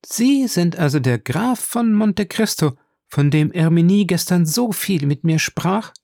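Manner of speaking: lady stunned to meet the famous count; astonished lady. A narrator's reading. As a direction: realise in wonder who he is. Lady realises who he is.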